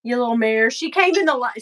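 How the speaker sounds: normal talking voice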